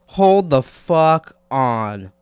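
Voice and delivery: very slow, drawn-out disbelief; stunned, slow. Real, not acted. Finding David's music, stunned and slow. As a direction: stretch every word in shock.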